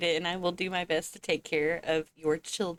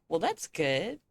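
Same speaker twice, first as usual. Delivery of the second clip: usual speaking voice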